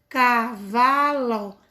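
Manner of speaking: slow, syllable by syllable: ca-va-lo; "cavalo" dito sílaba por sílaba, devagar; syllables spelled out. Shown as a demonstration. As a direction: break "cavalo" into its three syllables for the class. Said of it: "Foram três pedacinhos que saíram da minha boca".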